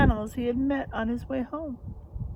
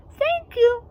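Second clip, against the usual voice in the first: much higher, louder thank-you; high grateful dog; 6 dB louder than usual. Acted. The dog thanks George, high and bright. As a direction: chirp it as a thankful little dog.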